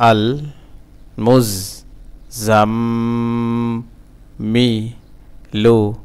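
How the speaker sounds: "আল মুজ জাম, মি, লু" in separate syllables, the m of "জাম" held; broken syllable-by-syllable. Shown as a demonstration. Teacher breaking the word for learners, syllable by syllable. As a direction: sound out "আল মুজ জাম, মি, লু" piece by piece. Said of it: "ভাঙা ভাঙা".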